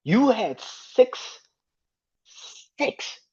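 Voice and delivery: high-pitched exasperated ranting at a player; ranting in real disbelief, voice pitched well up; real angry rant. Real, not acted. Fan venting at Draymond over the foul-out, real angry high rant. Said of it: "that shit pissed me off".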